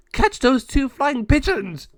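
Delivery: high, loud, slow gargoyle yell; gargoyle shout. Acted. Gargoyle ordering the others, high loud shout. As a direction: bellow the order like a silly monster.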